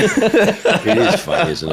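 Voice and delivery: high, slow, laughing. Real, not acted. Host marvelling at secret collections, laughing. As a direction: laugh it out slowly.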